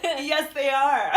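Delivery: slow, laughing yes; laughing sarcasm. Real, not acted. Sarcastic laugh about auditions.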